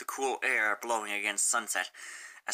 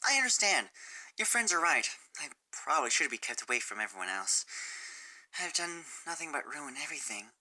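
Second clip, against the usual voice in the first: dejected Sunset; 4 semitones higher than usual. Acted. Sunset telling Fluttershy she ruins everything, dejected. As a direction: let it fall away to almost nothing.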